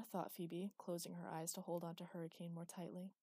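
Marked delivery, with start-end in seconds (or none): none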